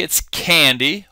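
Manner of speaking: normal talking voice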